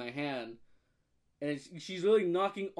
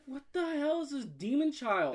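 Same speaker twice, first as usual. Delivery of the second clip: acted horrified disbelief; 9 semitones higher than usual. His horrified reaction retold.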